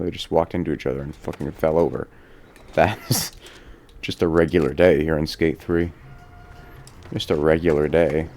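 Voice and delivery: ordinary delivery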